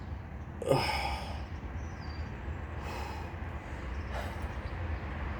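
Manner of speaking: soft, breathy sigh; long sigh. Real, not acted. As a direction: let out a long, quiet exhale. Long pause while checking the episode.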